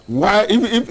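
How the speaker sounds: normal talking voice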